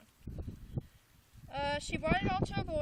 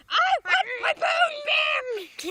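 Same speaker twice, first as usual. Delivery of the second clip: high, slow, booming ghostly shout; very angry ghost; 17 dB louder, much slower than usual. A narrator's reading. Furious ghost demanding its bone, booming shout. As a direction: shake the house with it. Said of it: "Now it sounded very angry".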